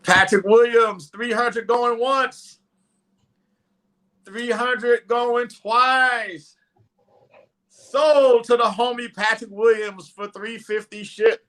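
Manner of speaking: slow, drawn-out, loud going once, going twice; auctioneer call. Acted. Auctioneer closing the sale.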